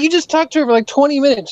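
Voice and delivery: a lightly voiced, high incredulous retort; incredulous protest. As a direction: push back in disbelief. Arguing with mom, incredulous.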